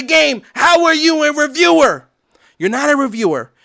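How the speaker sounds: loud, high, heated outburst; angry rant. Real, not acted. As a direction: call them out hard. Ranting at reviewers.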